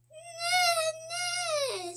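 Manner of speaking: high squeaky horse neigh; child playing the horse. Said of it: "says the horse".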